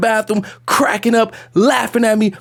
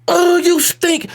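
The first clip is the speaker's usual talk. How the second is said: high, drawn-out disgust; slow jeer at the smell; hammed-up revolted woman's voice; mocking nurse; 9 semitones higher, slower than usual. Acted nurse mocking him.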